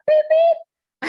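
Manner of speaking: high, slow beep beep; horn beep sounds. Pretend. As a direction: toot like a little horn. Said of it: "beep your own horn".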